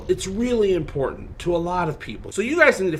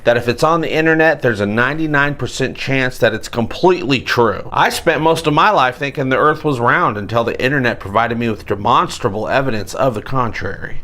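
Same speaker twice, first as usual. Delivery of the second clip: ordinary talk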